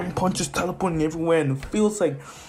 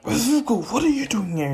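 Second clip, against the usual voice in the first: much higher, startled demand; surprised Bakugo; 9 semitones higher than usual. Retold or imitated. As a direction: caught off guard mid-fight.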